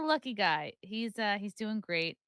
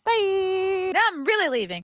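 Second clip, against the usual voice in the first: big held-note send-off; calling out goodbye; 11 semitones higher, 10 dB louder than usual. Real, not acted. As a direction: stretch the word out high and loud. Exaggerated long farewell.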